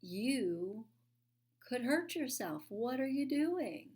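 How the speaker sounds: the inner voice raising the alarm. Retold or imitated. At the top of the wall, her inner voice warning her, alarmed. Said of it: "I'm hearing the voice say".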